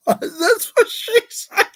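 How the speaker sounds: high, cracking, near tears laughing; can't hold the laugh in; laughing through water. Real, not acted. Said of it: "hold water in my mouth".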